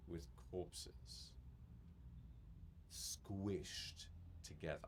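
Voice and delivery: hushed scene setting. Acted. GM sets a grim scene, hushed.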